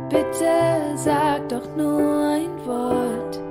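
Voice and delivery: usual speaking voice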